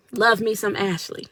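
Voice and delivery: usual speaking voice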